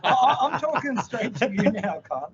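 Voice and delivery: hammed-up fury aimed at a viewer; play-angry rant straight down the mic; mock rant. Ranting straight at the commenter.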